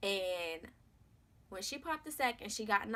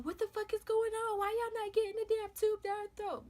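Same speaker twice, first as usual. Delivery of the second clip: much higher, frantic cussing; cussing out staff; 13 semitones higher than usual. Retold or imitated. Grandmother yelling at the doctors over the baby's tube, frantic and high. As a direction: demand answers from the doctors in a panic. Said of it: "crying and cussing them people out".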